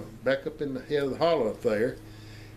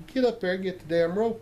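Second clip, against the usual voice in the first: higher, sharp retold command; angry order; 7 semitones higher than usual. Young man ordering Clemens to fetch the rope, angrily. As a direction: bark the order at him.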